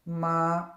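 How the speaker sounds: "Ma" held high and level; first tone, flat. Shown as a demonstration. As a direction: hold "Ma" on one high, steady note. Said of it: "first tone is flat".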